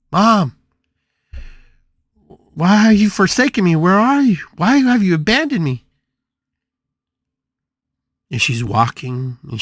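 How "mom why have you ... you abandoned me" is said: high, loud acted cry of abandonment; calling for mom. Daughter searches the house for her mom, calling out high. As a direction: call out in hammed-up desperation. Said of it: "calling for her mom".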